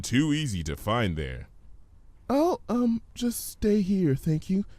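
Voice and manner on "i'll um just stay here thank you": timid pony voice. Acted. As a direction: voice a shy pony, high. Fluttershy voiced in story.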